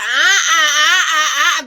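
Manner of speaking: loud mock wailing stammer; loud hammed-up sobbing uh-uh-uh; wailing mama. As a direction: wail the mother's grief, hammed-up. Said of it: "Mama was like".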